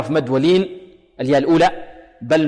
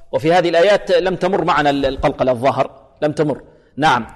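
normal talking voice

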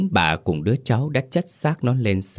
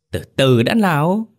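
plain reading voice

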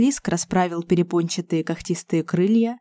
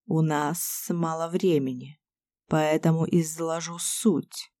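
low, soft, slow serpent voice; a calm, sly snake king; basilisk voice; 3 semitones lower, 6 dB quieter than usual. A narrator's reading. Basilisk speaks.

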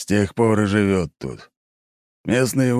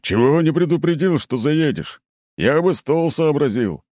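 powerful booming voice; 6 semitones higher than usual. A narrator's reading. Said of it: "Голос у него оказался мощным".

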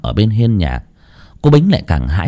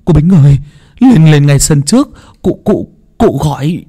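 urgent call; 6 semitones higher, 4 dB louder than usual. A narrator's reading. Servant runs up urgently.

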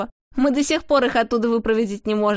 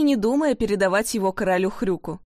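ordinary delivery

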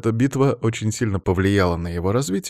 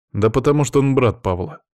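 ordinary delivery

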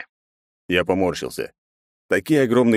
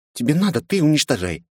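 put-on high young girl voice; girlish voice; 8 semitones higher than usual. A narrator's reading. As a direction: pitch up as a pouty teen. Leni's voice in narration.

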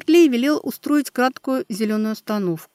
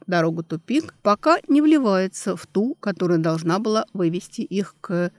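plain reading voice

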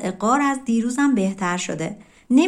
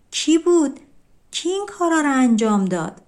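surprised question; 6 semitones higher than usual. Acted. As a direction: voice the brothers' amazement. Brothers amazed, asking who did it.

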